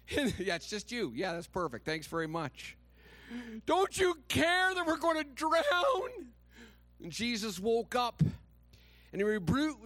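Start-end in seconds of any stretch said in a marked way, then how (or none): 3.7-6.3 high loud frantic wail; hammed-up desperate disciples crying out; panicked cry. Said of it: "disciples shouting in the storm".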